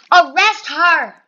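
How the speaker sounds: loud, drawn-out order; mock-outraged command. Cashier demanding a player's arrest, loud outraged command. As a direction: call for the guards in mock outrage.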